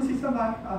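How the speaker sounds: restrained mention of the name; subdued. Acted. Partner giving up the alter ego, subdued. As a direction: say it without any force.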